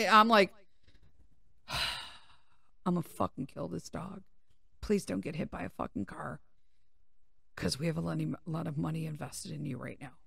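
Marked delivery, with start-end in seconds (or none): 1.7-10.1 quietly seething at the dog; exasperated muttering. Retold or imitated. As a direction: mutter it under your breath after a sigh. Retold muttered threat at runaway dog.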